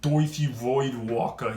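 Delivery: put-on slow Brooklyn accent on "Doithi Voidwalker", the "oi" drawn out. Card reviewer jokingly renames the card, Brooklyn accent. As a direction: drawl "Doithi Voidwalker" the way a Brooklyn local would. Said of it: "in a, uh, Brooklyn accent".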